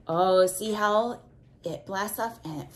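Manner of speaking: ordinary delivery, nothing marked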